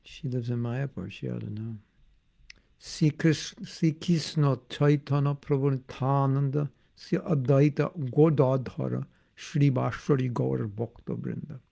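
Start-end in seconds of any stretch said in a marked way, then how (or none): 2.8-11.6 mantra recited in a Bengali accent; rounded Bengali vowels, sh for s. Shown as a demonstration. Devotee showing his accent with a mantra, Bengali accent. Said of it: "I can also do a Bengali".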